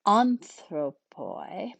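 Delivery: "anthropoi," stretched out slowly; careful word demonstration. Shown as a demonstration. Teacher models Greek example word.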